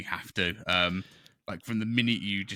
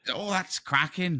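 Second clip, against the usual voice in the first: high, slow, hammed-up Wallace voice; terrible Wallace impression; 10 semitones higher, slower than usual. Player mimicking Wallace after scoring, badly hammed-up Wallace. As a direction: drawl it out like a cheery Wallace. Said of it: "that's a terrible Wallace impression".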